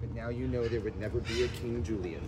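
ordinary delivery

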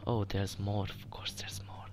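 exasperated whisper, fading; resigned whisper. Real, not acted. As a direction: sigh it out in a whisper. Discovering more enemies while hiding.

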